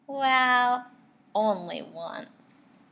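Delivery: usual speaking voice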